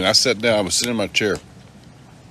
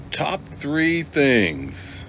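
higher, slow and drawn-out teasing; 7 semitones higher, 4 dB louder, much slower than usual. Acted. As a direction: tease the guest, stretching words. Teasing guest with demands.

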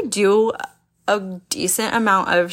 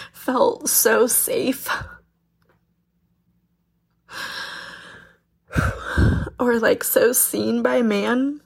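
tearful, voice pitched up; crying; 4 semitones higher than usual. Real, not acted. Tearful, telling her podcast listeners about her boyfriend. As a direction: speak through real tears. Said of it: "Yeah, I'm crying".

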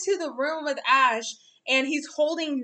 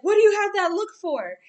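Ash's puzzled question; 7 semitones higher, 6 dB louder, faster than usual. Retold or imitated. Ash questioning Mister Mime.

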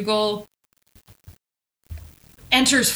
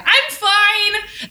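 insistent I'm fine; 10 semitones higher, 3 dB louder than usual. Retold or imitated. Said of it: "that is also how the episode starts".

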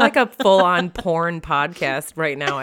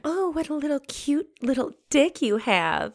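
put-on baby talk; 8 semitones higher than usual. Mocking cutesy talk.

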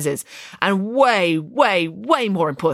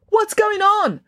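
high muffled demand; muffled through glass; 5 dB louder, slower than usual. A narrator's reading. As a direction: call through a closed window. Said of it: "her muffled voice demanded".

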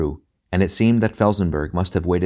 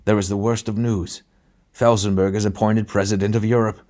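strange, breathless voice, a little higher; breathless fright; 3 semitones higher than usual. A narrator's reading. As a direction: gasp out the worst news in alarm. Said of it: "he said in a strange, breathless voice".

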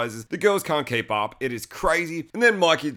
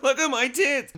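high, teasing flaunt, pitched up; mock flirty flaunt; 12 semitones higher than usual. Candy flaunting at the director, in a high mock tease. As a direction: tease him, showing off.

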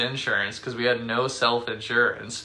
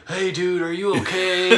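high, sudden caring boss; fake concern; 7 semitones higher, 4 dB louder than usual. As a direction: switch to sugary worry. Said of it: "immediately changed his tone to".

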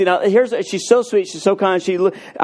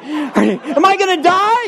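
high laughing panic; mock fear, laughing; 10 semitones higher than usual. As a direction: ham up the scared question. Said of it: "You know, like".

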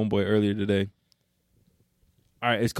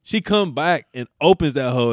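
usual speaking voice

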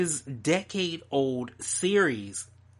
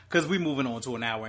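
normal talking voice

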